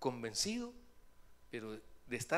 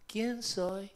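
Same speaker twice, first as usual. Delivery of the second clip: playful surprise; 6 semitones higher, 5 dB louder than usual. Retold or imitated. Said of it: "le voy a decir".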